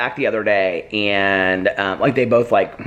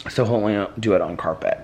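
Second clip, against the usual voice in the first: ordinary talk, nothing marked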